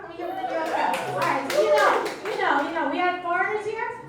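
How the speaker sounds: loud, high stage call; rousing the crowd. Real, not acted. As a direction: call out over a cheering crowd.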